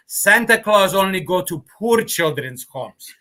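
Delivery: put-on mom's words in a light Greek accent, slow; a hint of a Greek mother, slow and firm. Greek accent as he quotes his mom to the hosts. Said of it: "do that with the Greek accent".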